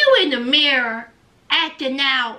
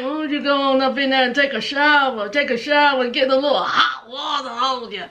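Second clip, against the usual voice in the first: Blaine; 4 semitones lower, faster than usual. Retold or imitated. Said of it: "he was like".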